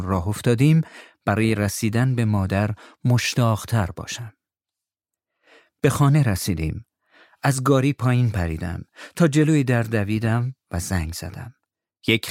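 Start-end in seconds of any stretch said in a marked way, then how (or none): none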